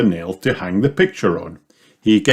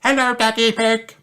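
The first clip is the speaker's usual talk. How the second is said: very high monotone receptionist voice; high-pitched voice over intercom. A narrator's reading. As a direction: answer as a high, level intercom voice. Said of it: "says a voice".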